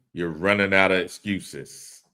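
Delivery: usual speaking voice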